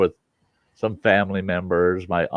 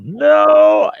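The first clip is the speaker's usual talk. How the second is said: sharp, much higher and louder shout of warning; yelling; 10 dB louder than usual. Retold or imitated. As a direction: stop him mid-bite with a yell. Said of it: "my aunt is yelling across the table".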